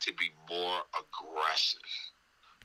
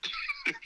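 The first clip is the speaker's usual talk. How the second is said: laugh; 3 dB quieter than usual. Real, not acted. Laugh after remark.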